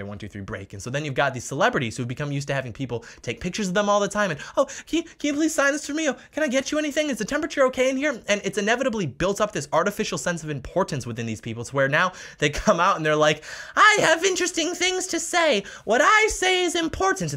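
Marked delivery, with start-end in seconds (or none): 4.6-8.2 much higher, gushing sycophant voice; mock the fawning fans. 13.8-17.3 much higher, slow, haughty mock voice; slow high mocking diva; pompous celebrity. Celebrity voiced as self-important.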